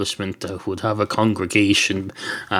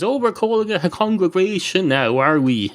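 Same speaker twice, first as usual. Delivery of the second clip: sarcastic retort; 8 semitones higher than usual. Acted. Cat sneers at the barman.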